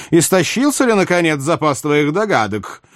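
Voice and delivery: high-pitched royal question; theatrical impatient monarch; needling king. Acted. Narrator voices King Louis.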